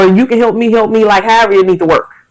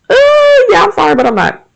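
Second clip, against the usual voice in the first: much higher, mock half-hearted "yeah, I'm sorry"; insincere apology; 14 semitones higher, faster than usual. Mocking someone who apologizes without meaning it, insincere tone. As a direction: toss off a fake apology.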